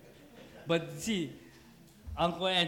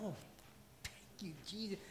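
softer grateful aside; 16 dB quieter than usual. Retold or imitated. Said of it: "It's like".

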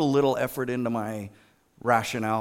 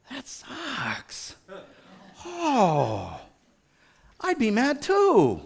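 high, drawn-out commiserating whine; hammed-up sympathy; 9 semitones higher, much slower than usual. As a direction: wail along with an upset kid to validate them. Acting out sympathy with upset pupil.

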